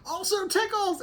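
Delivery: sudden high, loud squeal; spring it as a squeaky surprise; playful tickle attack. Retold or imitated. Dad surprising baby with tickles, high playful cry.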